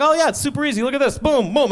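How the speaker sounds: high, hyped salesman pitch; carnival barker. Retold or imitated. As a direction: sell it like a carny. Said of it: "the guy's like".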